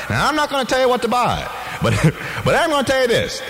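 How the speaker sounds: ordinary talk, nothing marked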